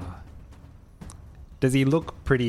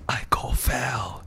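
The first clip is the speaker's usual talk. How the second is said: whisper; slower than usual. Acted. Invisible rogue whispers before striking.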